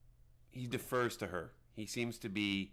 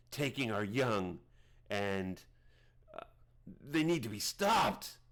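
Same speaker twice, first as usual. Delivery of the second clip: put-on an old seer spelling out doom to the strangers; drawn-out, ominous storytelling voice; shaman Guinness; slower than usual.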